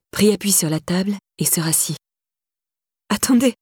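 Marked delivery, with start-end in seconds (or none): 3.1-3.5 shocked repeat. A narrator's reading. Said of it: "répéta-t-elle".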